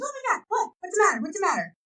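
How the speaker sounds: very high, worried questions; alarmed stepmom. Retold or imitated. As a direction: play the startled stepmom rushing in.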